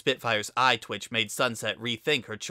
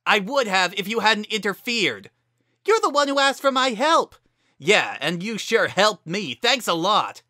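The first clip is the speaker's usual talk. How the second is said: angry shouting; 10 semitones higher, 8 dB louder than usual. A narrator's reading. Heated argument.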